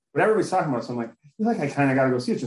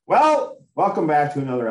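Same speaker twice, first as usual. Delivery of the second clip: excited welcome; 4 semitones higher, 6 dB louder than usual. Real, not acted. Welcoming listeners to the episode.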